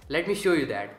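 normal talking voice

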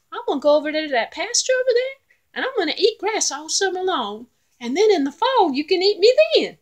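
high, loud little goat voice; pleading little goat. Acted. Little goat talking the troll out of eating him, high and pleading. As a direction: wheedle like a tiny clever goat.

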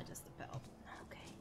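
soft whisper. Real, not acted. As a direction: whisper it, disappointed. Whispered aside.

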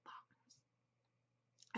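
a tired whispered aside, half to herself; whispered echo. Real, not acted. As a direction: whisper the word bonkers to yourself.

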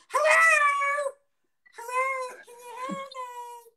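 very high, piercing, drawn-out squeal; baby voice. Acted. Dad calling to passer-by from car, squealing baby voice. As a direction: call out like a tiny lost child. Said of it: "The, the baby voice".

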